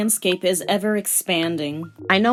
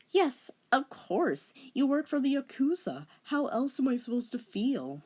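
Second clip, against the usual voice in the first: high melodramatic sigh; melodramatic anime girl; 7 semitones higher, 10 dB quieter than usual. Acted. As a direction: play up the heroine's hurt. Girl character.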